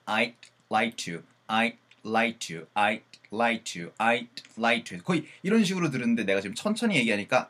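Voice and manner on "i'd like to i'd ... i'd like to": very slow I'd like to, each sound laid out. Shown as a demonstration. Teacher slowing I'd like to so students catch the d. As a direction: drag "I'd like to" out slowly so the d is heard. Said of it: "천천히 들으면".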